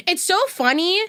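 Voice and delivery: ordinary talk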